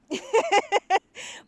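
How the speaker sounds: high loud burst of laughter. Real, not acted. Recalling the Maltese, loud high laugh. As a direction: cackle out loud.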